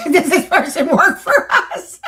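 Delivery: high, slow, through real laughter; laughing. Real, not acted. Co-host teasing about a demon, laughing. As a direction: ask it while cracking up. Joking question amid laughter.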